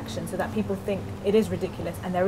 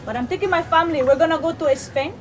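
lightly put-on Spanish accent, louder at first; 5 semitones higher, 5 dB louder than usual. Guest as Maria Gonzalez in a light Spanish accent. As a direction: play a Spanish mum going on holiday. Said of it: "My name is Maria Gonzalez".

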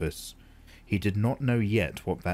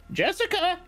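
calling out. A narrator's reading. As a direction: call her in from outside. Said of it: "called out".

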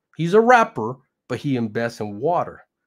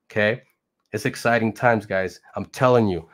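usual speaking voice

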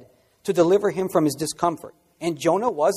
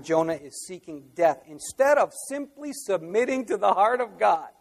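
ordinary talk, nothing marked

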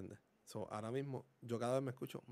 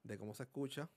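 gain turned way down; 8 dB quieter than usual. Shown as a demonstration. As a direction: let the mic gain drop so the voice sounds far and weak. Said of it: "Yo le puedo bajar el gain bien brutal".

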